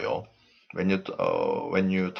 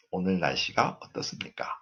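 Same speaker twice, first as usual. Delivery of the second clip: softer take, "어떻습니까" still lifting at the end; rising intonation; 2 semitones lower, 8 dB quieter, much faster than usual. Shown as a demonstration. Teacher repeats the example softly, rising on "어떻습니까". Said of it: "goes up".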